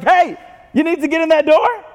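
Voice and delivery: strut it like the one with the keys; cocky Peter. Retold or imitated. Peter showing off to the disciples, cocky and high. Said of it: "He's like".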